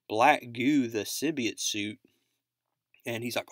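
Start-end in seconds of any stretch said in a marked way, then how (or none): none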